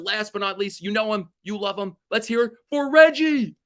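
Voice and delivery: high, building to a loud shout; mock ballpark announcer, rising to a roar; stadium announcer. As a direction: announce him to four thousand fans. Announcing Reggie to the crowd.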